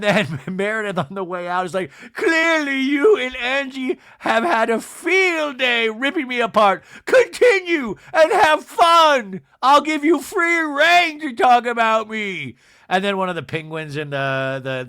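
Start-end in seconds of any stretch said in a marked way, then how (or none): 2.1-12.6 very high, loud, drawn-out mock shriek; hammed-up imitation of an outraged exit; melodramatic exit tirade. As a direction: shout it as a wailing parting shot. Meredith's furious exit speech, retold.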